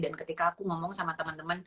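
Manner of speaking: usual speaking voice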